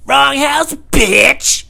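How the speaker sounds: high, loud taunting yell; hammed-up bravado; mock tough shout. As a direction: threaten the burglars mockingly. Repeating mock threat.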